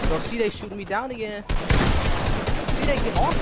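high, loud frustrated yelling; raging gamer. Real, not acted. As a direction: rage at a teammate. Raging at teammate shooting him.

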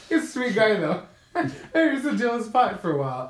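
amused laughing. Real, not acted. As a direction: chuckle through the remark. Laughs about the old boyfriend.

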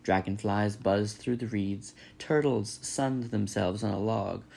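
ordinary delivery, nothing marked

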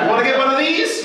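hammed-up wild American hollering hello. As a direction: put on an over-the-top American act. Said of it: "So I put on this act".

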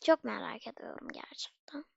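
soft unvoiced aside; whisper. Real, not acted. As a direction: whisper it like a secret. Whispered curiosity. (fısıltıyla merak)

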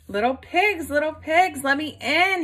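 a hungry wolf demanding at the door; growling wolf. Acted. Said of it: "he growled".

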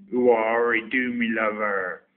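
a hammed-up West Country "do me lover"; broad Somerset phrase. Shown as a demonstration. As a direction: lay on the Somerset burr for the catchphrase. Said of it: "Do you think you have an accent?".

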